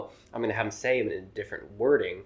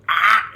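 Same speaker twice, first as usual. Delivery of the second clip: loud crude "ah!" moan, higher and hammed-up; loud mock moan; 10 semitones higher, 11 dB louder than usual. Comedian ends his open-mic bit with a loud hammed-up moan. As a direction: blast a fake moan into the mic. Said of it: "did it really loud into the mic".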